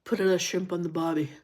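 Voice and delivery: quick mock Australian accent, tossed off; a fast throwaway Aussie impression; playful Australian twang, rattled off quickly. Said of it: "I have an Aussie".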